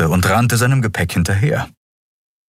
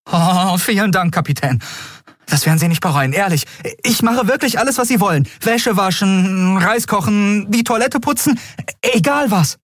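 put-on high, breathless eager boy; 13 semitones higher than usual. Miko to the captain promising anything, eager and high. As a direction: gush gratefully, high.

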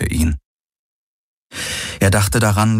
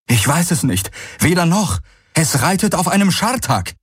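higher, breathless alarmed warning; alarmed report; 11 semitones higher than usual. Acted. Messenger warns Kessli of a stranger, high and alarmed. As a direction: bring bad news in a rush.

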